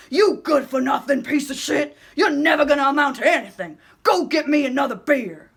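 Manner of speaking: put-on a drunken father screaming at his son for beer; drunk father yelling. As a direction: scream it at the boy. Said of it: "yelling at him".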